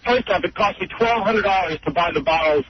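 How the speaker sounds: ordinary talk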